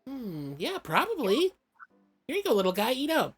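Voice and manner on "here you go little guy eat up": high, sing-song feeding voice; higher-pitched pet talk; coaxing a pet. Acted. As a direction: coax a tiny creature to eat in a higher voice. Feeding the little slime.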